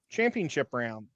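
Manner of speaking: ordinary talk, nothing marked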